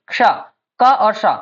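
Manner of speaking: क्षा slowly, then its parts का and शा; kṣa split in sounds. Shown as a demonstration. Teacher corrects chha, क्षा broken into का and शा. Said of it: "ये छा नहीं है".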